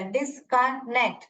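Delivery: "डिसकनेक्ट" slowly in three beats, dis-con-nect; syllable by syllable. Shown as a demonstration. Teacher counting syllables for the class, word split in three. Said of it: "तीन syllable".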